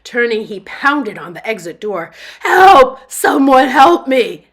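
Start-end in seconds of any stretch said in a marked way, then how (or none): 2.4-4.5 screaming for help, high and loud; desperate scream. A narrator's reading. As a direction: pound the door and scream for help. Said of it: "Bob screamed so loudly".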